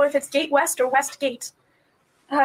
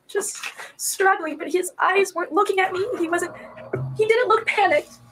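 ordinary delivery

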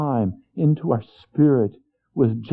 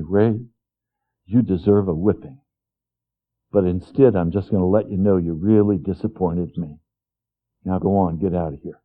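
stern father; 6 semitones lower than usual. Retold or imitated. Father telling young Ray he disappointed him, hushed. Father voiced in retold memory.

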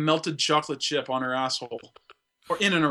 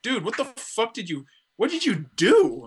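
appalled disbelief; 5 semitones higher than usual. Acted. Rental owner to customer who wrecked the doll, appalled. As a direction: stretch it out in mock horror.

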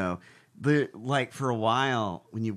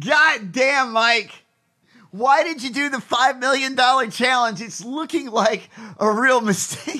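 exasperated yelling; 9 semitones higher, 5 dB louder than usual. Real, not acted. Scolding the absent Mike Lindell.

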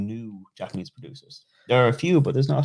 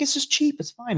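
much higher put-on voice, shrugging it off; imitating a casual friend. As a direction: toss it away lightly in a high voice. Friend voiced pouring rare whiskey.

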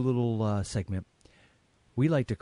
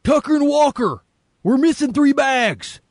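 much higher and louder, slow menacing bark; hammed-up villain Travers; 9 dB louder, slower than usual. Podcast host reading Travers' line, hammed-up villain bark. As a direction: overplay a henchman's outraged demand. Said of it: "I'll be Travers".

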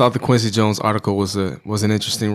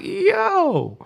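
very high-pitched, laughing "yo" of disbelief; retold shock, pitched way up through laughter; stunned disbelief. Reacting to [name] gossip, high stunned disbelief.

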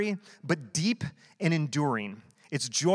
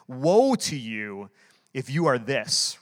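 slow, leaned-on woe, louder; grave warning; 2 semitones lower, 3 dB louder, slower than usual. Shown as a demonstration. Pastor showing the congregation how Jesus meant it, slow and grave. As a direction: pronounce doom slowly and heavily. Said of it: "signifies impending doom".

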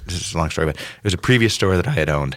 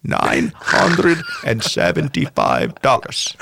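put-on slow, drawn-out Russian accent, each number weighed; 4 semitones higher, much slower than usual. Old Russian buyer raises his bid in a slow Russian accent. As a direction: haggle patiently in a thick Russian accent. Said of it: "Your, your Russian American accent was on point".

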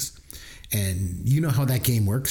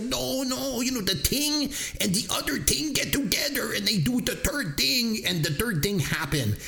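high, insistent mock French Canadian accent; mock French Canadian mom repeating herself, pitched high; 11 semitones higher than usual. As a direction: insist in a hammed-up Quebec accent. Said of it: "your insensitive French accent".

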